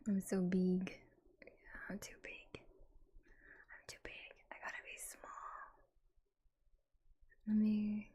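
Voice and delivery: usual speaking voice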